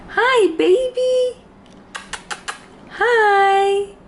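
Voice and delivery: put-on high, slow cooing baby talk. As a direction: coo up high at a puppy. Cooing at the dog.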